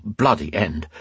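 ordinary delivery, nothing marked

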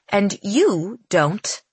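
slow, drawn-out jab; snide taunt. Acted. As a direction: let the insult drip out slowly. Evangeline insults Cal, teeth bared.

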